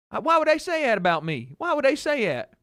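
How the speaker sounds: high, wounded imitation of dad; upset dad. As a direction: protest like a hurt, indignant father. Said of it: "He was so pissed".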